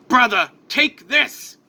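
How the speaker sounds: high, slow, emphatic call-out; urgent call. Acted. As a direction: call to your brother as you throw it. Calling out to brother.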